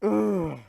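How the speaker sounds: loud fed-up groan; frustrated groan. Real, not acted. Reviewer worn out by the Blastoise scene, loud groan. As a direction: groan like you've had enough.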